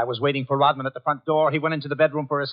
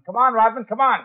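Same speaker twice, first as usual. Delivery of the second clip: higher, louder call through the door; impatient call; 7 semitones higher, 5 dB louder than usual. Acted. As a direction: call him again, sharper and louder. Calling again, no answer.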